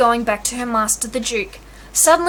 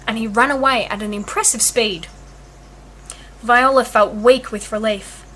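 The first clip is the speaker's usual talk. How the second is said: ordinary delivery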